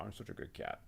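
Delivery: soft whispered cat voice. Acted. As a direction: speak for the cat in a hush. Voicing the cat.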